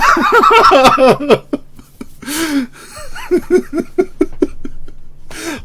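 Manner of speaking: high, sustained helpless laughter; long laughing fit. Real, not acted. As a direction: laugh until breathless. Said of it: "I'm laughing".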